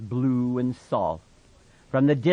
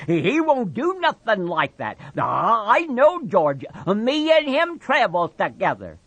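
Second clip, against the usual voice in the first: higher, louder desperate insisting; cling to it desperately; frantic Lenny; 10 semitones higher, 5 dB louder than usual. Acted. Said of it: "Lenny cried frantically".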